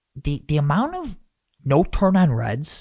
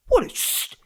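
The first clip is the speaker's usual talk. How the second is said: angry dad. Retold or imitated. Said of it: "he gets so angry".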